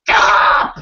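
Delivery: loud, harsh yelled command; scream. Pretend. Goblin jumps up at the stormy sky, screaming. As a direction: yell it out as a sudden order. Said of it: "and scream".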